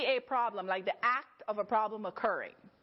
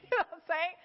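laughing; 6 semitones higher, faster than usual. Real, not acted. Laughing aside to congregation.

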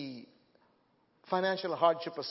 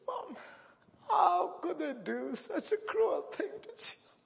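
high, tearful, half-laughing sob; crying question; 13 semitones higher than usual. Real, not acted. Eight-year-old asking mom about Jesus's death, sobbing. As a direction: ask through tears, voice breaking.

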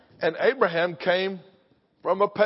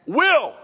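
Will shouted much higher and louder; stress on will; 11 semitones higher, 5 dB louder than usual. Shown as a demonstration. Preacher driving home certainty, shouted stressed Will. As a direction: nail down Will with force. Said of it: "Not might".

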